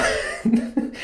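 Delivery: breathy high laugh; real, quiet exasperated chuckle. Real, not acted. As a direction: laugh in disbelief mid-rant. Laughs in exasperation mid-rant.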